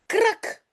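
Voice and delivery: high, sharp krak of tearing cloth; cracking noise. A narrator's reading. Teacher reading the pillow's sound, a sharp high crack. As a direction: pop out Krak like a seam ripping. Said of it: "zegt het kussen".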